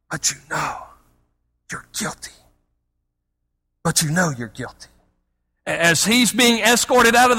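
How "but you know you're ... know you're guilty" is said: low, hushed accusing voice, half whispered; accuser Satan. Retold or imitated. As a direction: hiss the accusation like a prosecutor. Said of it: "he's still crying out".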